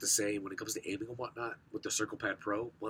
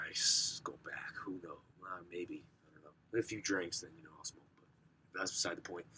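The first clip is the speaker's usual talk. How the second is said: near-whispered, much softer confession; hushed aside; 4 semitones lower, 6 dB quieter than usual. Real, not acted. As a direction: drop to a hush, admitting a vice. Quiet confession about smoking.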